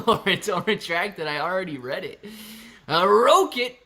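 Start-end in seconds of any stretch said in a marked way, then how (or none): 3.1-3.8 high rolled-R rocket with a long oh; imitating her rocket. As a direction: roll into "rocket" as rrROH-kit, pitched high. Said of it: "That's how she would say rocket".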